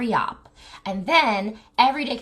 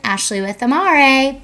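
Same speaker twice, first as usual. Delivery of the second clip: louder branded sign-off, stretched slowly; 3 semitones higher, 10 dB louder, slower than usual. Shown as a demonstration. Owner signing off her promo, loud slow brand tag. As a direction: sell the name Amare as a closing tag. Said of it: "sign-off with brand name".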